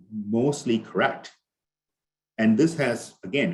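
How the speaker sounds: usual speaking voice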